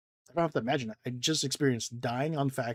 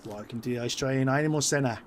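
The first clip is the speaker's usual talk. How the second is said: put-on Australian accent. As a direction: welcome them like a cheery Aussie host. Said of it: "it could be Australian".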